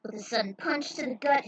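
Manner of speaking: usual speaking voice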